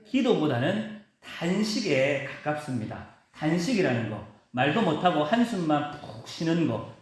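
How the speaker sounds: ordinary talk, nothing marked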